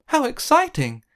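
high, loud thrilled cry; a girl squealing with excitement; excited Tara. A narrator's reading. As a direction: burst out with childlike delight. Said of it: "said Tara".

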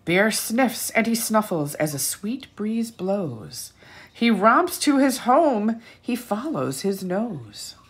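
lively storybook read-aloud; animated picture-book narration; reading to children. A narrator's reading. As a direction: read it like bedtime story time.

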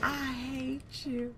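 slow, dragged-out I hate you; slow drawn-out hate. Pretend. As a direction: stretch every word sulkily. Repeating at killer.

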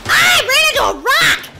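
high, loud crash yelp; startled shout. Real, not acted. Gamer crashes into a rock, startled shout. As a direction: cry out loud at a sudden crash.

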